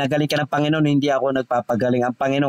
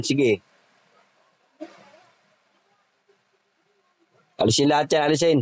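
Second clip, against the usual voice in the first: commanding spirits. Acted. Healer commands spirits to leave.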